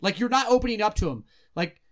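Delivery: ordinary talk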